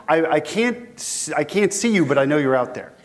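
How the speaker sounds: usual speaking voice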